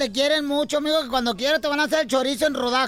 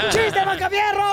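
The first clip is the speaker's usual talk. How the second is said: slow, high joke call; shouted announcement; 8 semitones higher than usual. Real, not acted. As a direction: call the next joker out. Host announces next joker. (anuncio gritado y lento)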